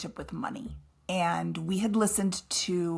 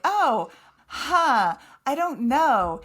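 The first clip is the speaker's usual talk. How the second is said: mildly hammed-up puzzled guest, louder and higher; imitated stumped guest; 5 semitones higher, 6 dB louder, much slower than usual. Host imitating a guest asked a question, stumped. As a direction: imitate a guest at a loss, loudly.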